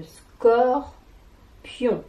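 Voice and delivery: "scorpion" as scor... pion; very slow "scorpion" in two beats; split into syllables. Shown as a demonstration. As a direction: stretch "scor", pause, then "pion". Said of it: "des syllabes qui composent".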